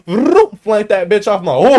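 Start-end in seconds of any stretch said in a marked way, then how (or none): 0.1-0.5 loud, high "vroom" noise; imitated belt whip-off. Telling how dad yanked his belt off, imitated noise. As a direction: make the belt whooshing through the loops.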